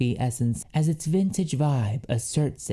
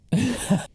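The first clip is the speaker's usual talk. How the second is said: bright high giggle; high laugh; 10 semitones higher than usual. Real, not acted. Laughing at herself over the perfume, a high giggle.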